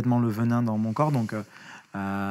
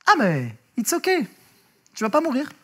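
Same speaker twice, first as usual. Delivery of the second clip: mock calm operator; 13 semitones higher than usual. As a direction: brush off the panic lightly. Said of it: "elle me dit".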